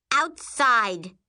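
loud, high one-word command; barked order. A narrator's reading. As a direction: bark it at your sister. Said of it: "she barks".

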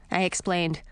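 usual speaking voice

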